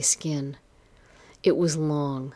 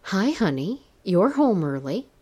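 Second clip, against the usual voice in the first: higher, sing-song greeting with nerves; nervous calling out; 5 semitones higher than usual. A narrator's reading. Wife greeting whoever came in, calling out nervously. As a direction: call out to a husband, uneasily. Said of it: "I called out. My voice sounded as shaky as I felt".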